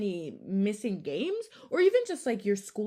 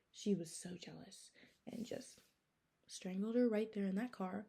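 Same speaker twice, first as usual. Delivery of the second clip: whispered storyteller ending, softer; hushed whisper; 9 dB quieter than usual. A narrator's reading. Narrator revealing the murder to viewers, hushed whisper. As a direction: drop to a creepy whisper for the reveal.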